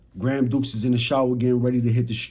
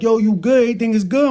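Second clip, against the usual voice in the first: higher and louder, calling with concern; a grandma sensing trouble; worried grandmother; 8 semitones higher, 4 dB louder, faster than usual. Retold or imitated. Grandmother calling from her room, high and worried.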